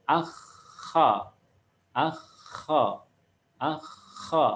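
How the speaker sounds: "Akha" with long throat friction and open A; open kha. Shown as a demonstration. Teacher drilling fathah, "Akha" with open final A. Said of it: "fathah tetap terbuka".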